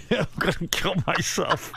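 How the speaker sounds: laughing in exasperation. Real, not acted. Son despairing over another storm, laughing. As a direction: let the words break up in laughter.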